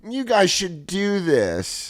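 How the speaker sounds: slow, high, drawn-out mockery; mock preachy. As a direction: imitate a smug armchair activist. Mocks online activists at home.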